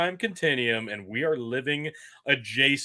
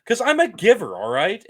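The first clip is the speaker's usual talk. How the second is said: defiant; 9 semitones higher, 9 dB louder than usual. Real, not acted. Defiant retort to co-host.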